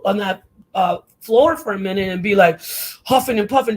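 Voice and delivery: ordinary delivery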